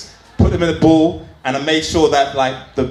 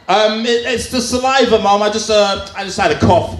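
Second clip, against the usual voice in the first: higher, guilty stammer; nervous excuse; 6 semitones higher than usual. Retold or imitated. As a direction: fumble the lie. Nervous lie to mum.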